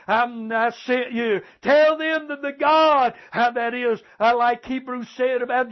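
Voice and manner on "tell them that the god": high, slow pulpit proclamation; preacher calling out to the congregation; impassioned preaching. Real, not acted. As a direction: proclaim it with a raised, drawn-out voice.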